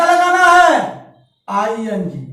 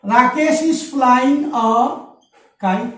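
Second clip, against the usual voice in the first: slow dictation; much slower than usual. Shown as a demonstration. Teacher dictating the English sentence.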